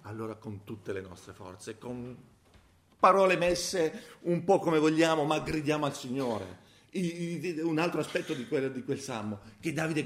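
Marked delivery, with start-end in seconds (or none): 3.0-6.6 fervent preaching. Acted. Preaching burst.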